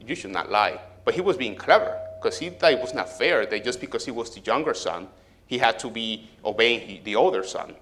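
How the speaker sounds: usual speaking voice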